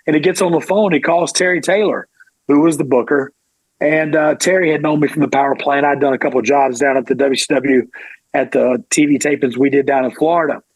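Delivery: normal talking voice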